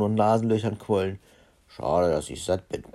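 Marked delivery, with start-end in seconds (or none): 1.7-2.8 low, burpy storybook voice; lowered voice thick with a belch; burping. A narrator's reading. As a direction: talk as if a burp is rising. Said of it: "rülpste er".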